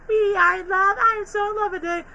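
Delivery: very high lovesick squeal; clingy Lola. Retold or imitated. Lola singing to Bugs, high lovesick squeal. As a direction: gush like a clingy girlfriend. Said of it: "she's like".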